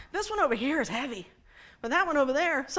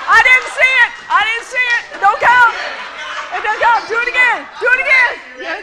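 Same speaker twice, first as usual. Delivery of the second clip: calling out; 9 semitones higher, 11 dB louder than usual. Real, not acted. Calling out volunteer's try.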